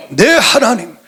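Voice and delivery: ordinary delivery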